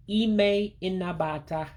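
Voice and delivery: Ime inabata slowly, high, every vowel sounded; every letter pronounced. Shown as a demonstration. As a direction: model Ime inabata as ee-may in-na-ba-tah. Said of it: "you pronounce everything you see there".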